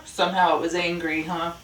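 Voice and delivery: plain reading voice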